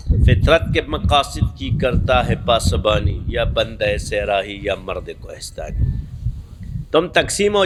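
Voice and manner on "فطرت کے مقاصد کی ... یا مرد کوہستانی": slow, measured recitation of a couplet; slow, solemn poetic recitation; reciting Iqbal's verse. Shown as a demonstration. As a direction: draw the verse out slowly like poetry. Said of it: "اس لیے علامہ اقبال کہتا ہے".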